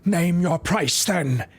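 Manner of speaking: slow, deliberate menacing villain voice. Acted. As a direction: savour each phrase like a villain. Voicing the villain's lines.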